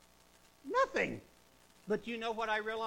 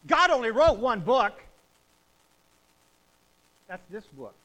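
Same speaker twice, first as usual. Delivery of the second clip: full-force preaching; 3 semitones higher, 11 dB louder than usual. Acted. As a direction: thunder it home to the congregation. Preaching climax.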